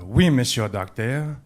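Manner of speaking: lightly acted French lady accent. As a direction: put on a French accent, drawing out each phrase. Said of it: "The young French lady says".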